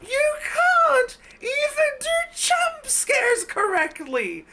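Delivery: high, slow shout breaking into laughter; laughing shout. Real, not acted. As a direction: yell it while cracking up. Ranting at bad jump scare.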